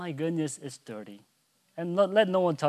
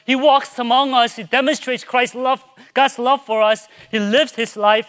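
higher, louder impassioned preacher; full-force preaching; 8 semitones higher, 10 dB louder than usual. Real, not acted. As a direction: preach it in a rising, urgent flood.

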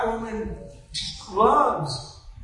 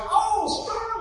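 high-pitched retold "oh"; acted realization; 13 semitones higher than usual. Speaker suddenly grasping the meaning, high mock realization. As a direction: let it jump up high as it dawns.

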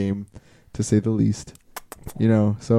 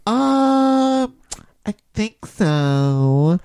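high, nose-held, very slow customer; annoying girl voice; 8 semitones higher, much slower than usual. Retold or imitated. Customer dithering over her order, annoying slow high voice. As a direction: drag each word out, pinched and high. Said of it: "you grabbing your nose".

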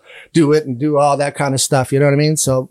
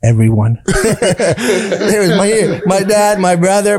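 laughing; 5 semitones higher, 4 dB louder, much slower than usual. Real, not acted. Answering the visit question.

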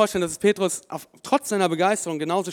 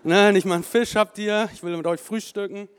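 louder, higher teasing jab at the fishermen; mocking taunt; 4 semitones higher, 7 dB louder than usual. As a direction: rub it in, playfully mocking. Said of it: "der hackt auch noch drauf rum".

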